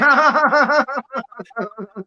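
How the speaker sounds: high laughing burst. Real, not acted. Laughing at his mardy-mood joke, high and loose. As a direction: laughs at his own cheeky line.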